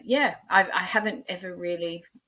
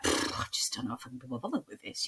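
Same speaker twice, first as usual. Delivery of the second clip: softer, half-whispered, can't be bothered; deflated; 4 semitones lower, 7 dB quieter, faster than usual. Retold or imitated. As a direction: puff out, then say it low in volume, giving up. Voices giving up after the criticism.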